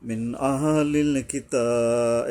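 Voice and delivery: slow recitation with a long aa in কিতাব; one-alif elongation. Shown as a demonstration. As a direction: stretch the taa of কিতাব. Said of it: "তায়ের উপরে এক আলিফ টানতে হবে". (কিতাব টেনে ধীরে পড়া)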